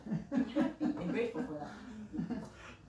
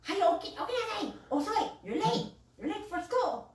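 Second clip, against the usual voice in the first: higher, urgent mom calling through the door; mock wake-up yell; 9 semitones higher than usual. Mom pounding on the door waking kids, urgent calling. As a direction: hurry the kids awake. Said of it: "pound on the door".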